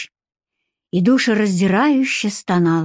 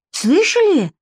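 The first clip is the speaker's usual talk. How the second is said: higher, livelier question; perking up; 10 semitones higher than usual. Acted. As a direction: perk up with sudden interest. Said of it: "оживилась Варя".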